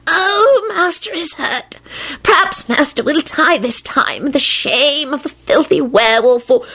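high, softened, gleeful elf lament; happy moaning. A narrator's reading. House-elf relishing his master's dreadful state, happy high moaning. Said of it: "he moaned happily".

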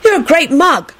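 put-on high, insistent boy voice; stubborn. A narrator's reading. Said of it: "he told Alex stubbornly".

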